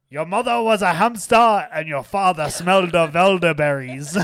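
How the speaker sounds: loud, high taunt with hammed-up French accent; mock French accent. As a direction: fling the insult in cartoon French. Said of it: "also in French, uh, the Holy Grail".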